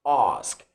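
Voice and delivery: "Ask" low, with the A of father; low British "Ask", long back vowel; British broad A. Shown as a demonstration. Teacher repeating the changed word, British "Ask". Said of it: "becomes ask".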